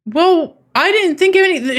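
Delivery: high, loud Southern belle voice; high-pitched Southern belle act. Acted. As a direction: put on a hammed-up Southern lady. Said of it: "Southern Belle".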